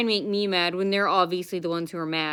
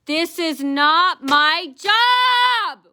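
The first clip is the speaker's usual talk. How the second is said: much higher, louder, very slow sneer; mocking whiny clerk; 9 semitones higher, 7 dB louder, much slower than usual. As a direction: stretch every word high to mock her. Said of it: "and she goes".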